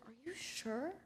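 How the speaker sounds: very soft, unsure question; hushed doubt. Retold or imitated. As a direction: ask it under your breath, rising at the end. Doubting it is really her.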